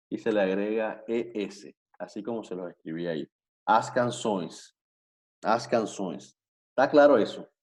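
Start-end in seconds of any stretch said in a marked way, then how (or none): 3.7-6.4 "As canções" with a nasal -ões ending; Portuguese pronunciation. Shown as a demonstration. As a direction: model "As canções" for learners, falling at the end. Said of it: "Las canciones sería". ("As canções" pronunciado en portugués, con final nasal)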